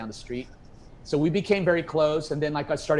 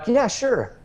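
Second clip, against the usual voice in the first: higher, louder retold enthusiasm; eager acceptance; 11 semitones higher, 6 dB louder than usual. Musician accepting a session offer, retold with high eagerness. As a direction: jump at it, pitched right up.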